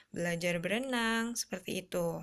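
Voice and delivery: usual speaking voice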